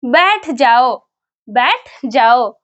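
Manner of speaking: very slow, clear model phrase; slow demo. Shown as a demonstration. Teacher presenting the next sentence to viewers, very slow. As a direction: draw out बैठ जाओ slowly for learners. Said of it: "next sentence है".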